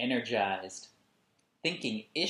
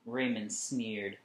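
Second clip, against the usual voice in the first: ordinary delivery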